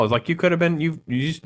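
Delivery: ordinary talk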